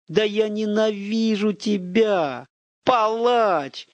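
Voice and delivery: furious hatred; hateful outburst. A narrator's reading. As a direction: lash out with every word.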